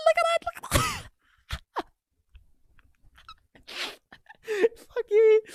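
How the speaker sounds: very high, loud cackling squeal; squealing laugh. Real, not acted. Fan mocking rival supporters with a squealing laugh.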